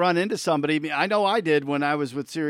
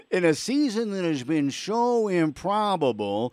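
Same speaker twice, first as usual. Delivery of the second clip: slow, high broadcaster call; play-by-play announcer voice, slow and grand; Vince Scully call; 4 semitones higher, slower than usual. Pretend. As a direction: savor each word like a legendary announcer. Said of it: "If you did it like Vince Scully".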